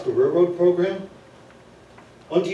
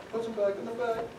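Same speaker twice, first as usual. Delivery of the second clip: ordinary talk, nothing marked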